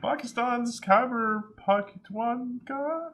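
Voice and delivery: slow, high-pitched stab at Pakhtunkhwa; botched place-name attempt. Real, not acted. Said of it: "you didn't come close".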